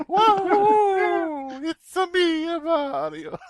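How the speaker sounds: very high, loud cartoon plumber voice; Mario impression. Host voicing Mario stuck in the garage, squeaky high cartoon voice. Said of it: "somebody put Mario in an unventilated garage".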